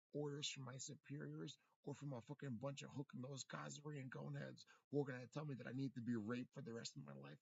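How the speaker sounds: ordinary talk, nothing marked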